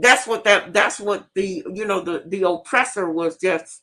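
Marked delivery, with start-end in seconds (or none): none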